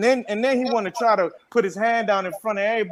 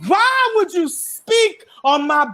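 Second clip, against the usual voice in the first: high, slow, drawn-out mock reprimand; chastising; 11 semitones higher, 4 dB louder, slower than usual. August tells Tory off over his business, imitated chastising. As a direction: scold him word by word, voice pitched way up. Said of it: "as if he was really chastising".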